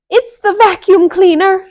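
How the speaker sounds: very high, loud fearful shriek. A narrator's reading. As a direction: shriek in panic. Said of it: "shrieked Melissa".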